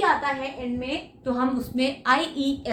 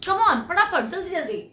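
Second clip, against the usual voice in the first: ordinary talk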